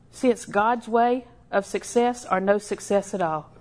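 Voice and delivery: normal talking voice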